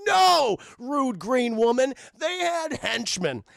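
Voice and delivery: sudden loud high "No", then slow put-down; mock indignant shout. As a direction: bark back at a heckler, hammed up. Zeus snaps at Ivy.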